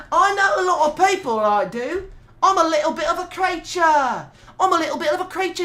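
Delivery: cheeky Cockney hype for the community; mock Cockney voice. Streamer praising his community in light mock Cockney accent.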